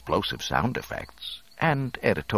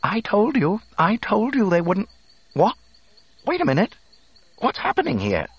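high, loud, alarmed robot voice; panicking droid; 5 dB louder than usual. A narrator's reading. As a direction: go from fussing to startled alarm.

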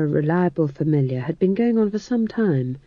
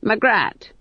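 sharp high call across the room; old witch snapping a name; Granny's call; 7 semitones higher than usual. Acted. Granny catching Magrat's eye, sharp call.

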